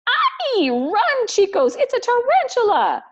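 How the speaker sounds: very high, loud frantic yelling; panicked shriek. Acted. Piranha yelling at the gang to run, high panic. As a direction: scream it like you're fleeing a spider.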